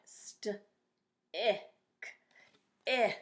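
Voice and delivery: "St-" and "ick" split apart, then the vowel "I" on its own; "St-", "ick", "I" slowly pulled apart; sounding out stick. Shown as a demonstration. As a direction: break "stick" into "St-" and "ick", landing on "I". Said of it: "what's missing in the word stick".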